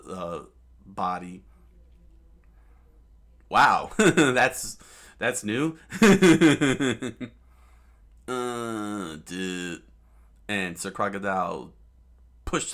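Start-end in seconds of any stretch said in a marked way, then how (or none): 5.8-7.3 loud, high giggle; delighted burst of laughter; real laugh. Real, not acted. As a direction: crack up at how silly it is. Teller laughs at silly scene.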